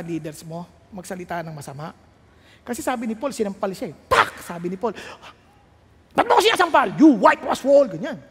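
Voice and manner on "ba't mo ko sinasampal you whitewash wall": loud, indignant acted retort; angry outburst. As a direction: lash out as if just slapped. (galit na pasigaw na sumbat)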